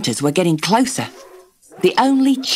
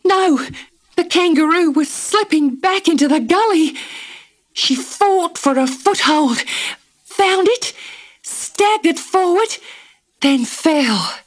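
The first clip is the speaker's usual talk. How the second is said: high, breathless suspense; tense narration; 11 semitones higher than usual. A narrator's reading. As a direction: tell it on the edge of your seat.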